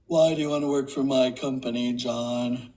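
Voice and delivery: very low put-on voice; deep, hammed-up character impression; made-up character. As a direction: drop way down deep, as an invented woman. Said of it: "I made her up".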